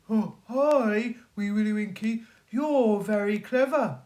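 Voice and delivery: butter him up; wolf flattering Wee Willie Winkie. Acted. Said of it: "said Mister Wolf".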